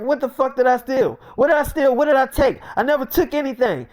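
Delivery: retold defensive outburst; indignant denial. Acted denial of stealing.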